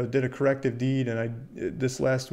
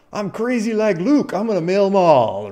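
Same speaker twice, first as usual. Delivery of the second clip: crazy mailer; 7 semitones higher, 7 dB louder than usual. Pretend. Investor hyping himself to mail California, mock-crazy and loud. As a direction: ham up a wild investor going all in. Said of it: "you say".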